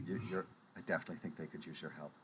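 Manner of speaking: fast, soft, insistent nudge; sly murmur. Acted. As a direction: rush it under your breath. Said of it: "I lean over".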